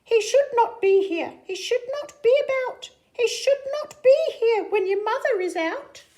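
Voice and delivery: high, loud, fretful fish voice; fish character voice. Acted. Fish warns the kids about the cat, high and fretful. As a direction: squeak out the fish's alarm. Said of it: "But our fish said".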